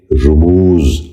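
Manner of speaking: رموز said "rumooz", short "u" after the r; correct pronunciation. Shown as a demonstration. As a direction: open رموز with "ru", then long "mooz". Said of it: "آپ بھی میرے ساتھ دہرائیے".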